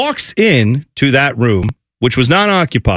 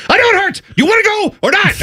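very high, strained retort; mock-exasperated dad, pitched way up; exasperated parent; faster than usual. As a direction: answer at the end of your patience. Exasperated dad answering crying child.

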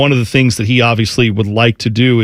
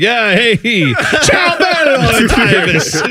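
laughing, hammed-up Italian "ciao, bello", much higher and stretched slowly; mock Italian greeting; 9 semitones higher, much slower than usual. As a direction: sing out a mock Italian hello, cracking up. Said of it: "So like".

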